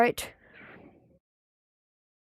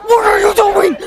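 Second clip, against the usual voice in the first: high, very loud yelling; alarmed shout; 4 semitones higher, 10 dB louder than usual. Real, not acted. Player watching the worm attack, shouting in alarm. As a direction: yell it in frantic disbelief.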